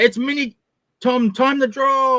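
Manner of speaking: high, loud opening announcement; hyped show intro. Pretend. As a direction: kick off the show, drawing out the words. Performs show intro before start.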